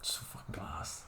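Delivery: barely audible whisper; whispered aside. Real, not acted. Commenting to himself on the film, whispered aside. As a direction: whisper it under your breath. Private aside during the video.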